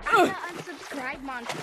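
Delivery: loud, slow playful monster. Pretend. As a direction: boom it out like a silly monster.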